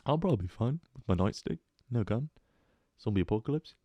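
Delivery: a touch of British cop, unbothered; hint of a British accent, softly offhand; mock British accent. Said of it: "I got British on the brain".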